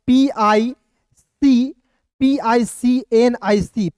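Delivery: letters पी आई सी एन आई सी spelt slowly; slow spelling. Shown as a demonstration. Teacher spells picnic slowly for the class. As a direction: drag out each letter clearly. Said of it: "पिसनिक नहीं पढ़ेंगे".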